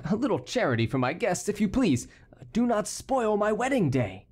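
high, anxious pleading; understated nervous appeal, pitched high; trembling plea. A narrator's reading.

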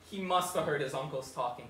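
ordinary talk, nothing marked

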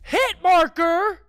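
high, loud, drawn-out yell; excited shout. Real, not acted. Streamer yelling at a lucky hit. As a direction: scream the hit marker out in triumph.

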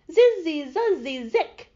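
high, loud buzzing spell words; magic incantation. A narrator's reading. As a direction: punch out the last words of a charm. Dorothy finishes the magic charm.

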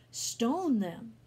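loud, forceful order; angry roar. A narrator's reading. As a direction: incite the mob loudly. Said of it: "the priest roared".

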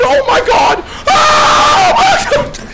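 screaming with joy. Real, not acted. Streamer to chat after a rare red, screaming with joy. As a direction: scream it like you called the jackpot. Max win hits.

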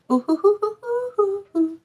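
playful falsetto hoo hoo; high hooting trill. Pretend. Tarot reader riffing playfully after a quote, high hooting. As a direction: sing out a high little hoo.

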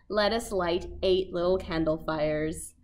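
ordinary delivery

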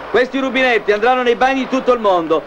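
ordinary talk, nothing marked

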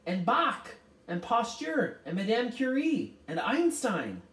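high, loud, eager listing; calling out. A narrator's reading. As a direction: rattle off names with mounting enthusiasm. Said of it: "Charles Wallace called out".

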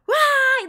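short high, loud scream; demonstrated scream. Shown as a demonstration. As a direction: let out a therapy-room scream, demonstrated. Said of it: "you scream on the top of your lungs".

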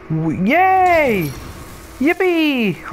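high, squealed mock celebration; a high, ironic yippee after wasting his bullets; sarcastic cheer. Real, not acted.

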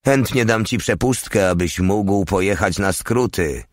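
ordinary delivery, nothing marked

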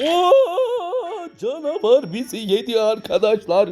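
high squealing laugh, slowed; excited laughing. Real, not acted. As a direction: laugh in shocked delight. Monster ate them, laughing.

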